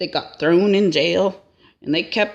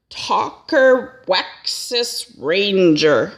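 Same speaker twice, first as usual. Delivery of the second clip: slow, drawn-out announcement of Talker Wexus Ranger; slowly billed name Talker Wexus Ranger, like introducing a headliner; grand name announcement; much slower than usual. Shown as a demonstration. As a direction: stretch out Talker Wexus Ranger like a showman's billing. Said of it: "Yeah, you heard correctly".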